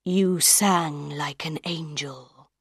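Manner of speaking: a soft, slow voice from nowhere; hidden man's voice. A narrator's reading. Unseen man praising Christine's singing, low and soft. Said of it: "the man's voice said".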